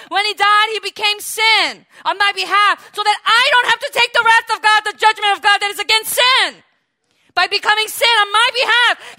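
preacher rousing the congregation, full force; preaching at full force. Acted. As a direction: lift the room, build to hallelujah. Preaching climax with hallelujah.

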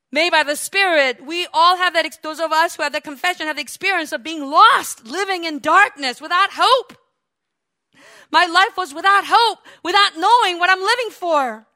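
loud, urgent preacher testimony; forceful preaching. Real, not acted. Preacher testifying of life without hope, forceful preaching. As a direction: press the point with fervor.

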